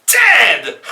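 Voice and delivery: high loud villain cackle; shrieking evil laugh. Acted. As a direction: cackle in triumph.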